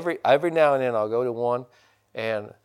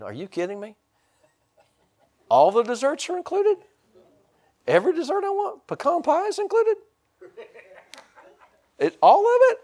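amazed disbelief. Retold or imitated. Learning desserts are included.

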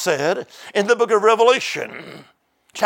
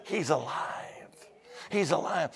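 hushed awe; 2 semitones lower, 11 dB quieter than usual. Retold or imitated. Preacher voicing Mary's news of the risen Lord, hushed. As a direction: drop to a breath for the news.

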